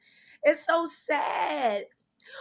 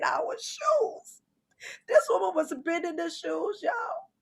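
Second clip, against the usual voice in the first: mock crying; 3 semitones higher, 4 dB quieter than usual. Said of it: "This is real tears".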